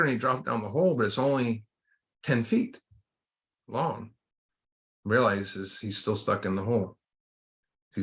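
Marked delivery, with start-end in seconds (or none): none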